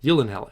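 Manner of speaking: "Yillenhell", starting on a Y, ending in "hell"; correct pronunciation. Shown as a demonstration. Host repeating the actor's surname as just learned, correct pronunciation. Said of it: "is actually correctly pronounced".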